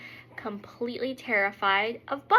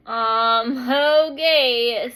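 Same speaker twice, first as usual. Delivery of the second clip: doubtful okay; 7 dB louder, much slower than usual. Retold or imitated. Acting her doubtful reply to husband.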